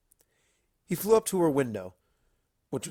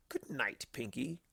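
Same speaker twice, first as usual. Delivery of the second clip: fond; 11 dB quieter than usual. Acted. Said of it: "patting her head fondly".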